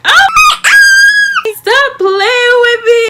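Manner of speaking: very high, loud, drawn-out shriek of excitement; excited squeal. Acted. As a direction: scream it out slowly, overjoyed and disbelieving. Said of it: "I'm just so excited".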